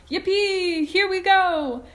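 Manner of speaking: high, loud whoop; shouted with joy. A narrator's reading. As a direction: cheer like on a ride. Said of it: "Grandpa Joe shouted".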